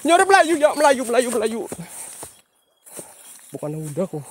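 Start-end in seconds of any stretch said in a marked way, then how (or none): 0.1-1.6 high, loud repeated call. Real, not acted. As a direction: shout the word out high. Calling out in a scary place. (teriakan keras)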